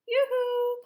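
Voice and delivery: high, loud sing-song call. A narrator's reading. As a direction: call through the door. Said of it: "called the egg lady".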